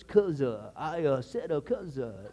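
preacher parody, "uh" tacked on each word; lightly spoof an evangelist's added "uh"; mock evangelistic. Shown as a demonstration. Pastor joking about preaching style, mock evangelistic. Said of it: "if you want to sound, uh, evangelistic".

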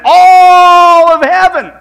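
high, loud excited shout. Real, not acted. Pastor telling the congregation heaven rejoices, shouting. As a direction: shout it out with joy.

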